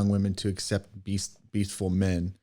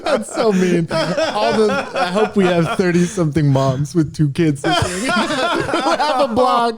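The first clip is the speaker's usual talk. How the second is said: high loud laughing speech; 10 semitones higher, 6 dB louder than usual. Real, not acted. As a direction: laugh through every word. Laughing banter.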